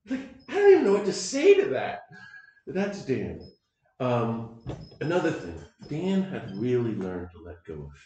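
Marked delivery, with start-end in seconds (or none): 0.1-2.0 speechless. Retold or imitated. Wallace left speechless, retold.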